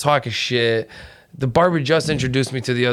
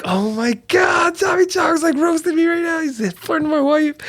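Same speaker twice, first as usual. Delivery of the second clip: excited shout. Retold or imitated. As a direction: shout it in giddy disbelief. Retold own excited reaction.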